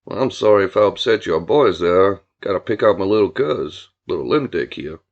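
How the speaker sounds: low-pitched, folksy country man; easygoing drawling Billy. Acted. Billy greeting Julia about his cousin, folksy low voice. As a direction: lay on the low, courteous charm. Billy's dialogue, story character.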